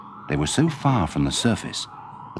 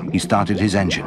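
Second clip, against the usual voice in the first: plain reading voice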